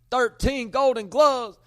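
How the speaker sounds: loud, high, hyped-up yelling of names; hyped shout-outs. Real, not acted. Host excitedly yelling shout-outs to his viewers. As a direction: hype the crowd, shouting every name.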